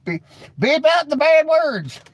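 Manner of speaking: high, loud, drawn-out mock yell; exuberant call. Mock cry for censor button.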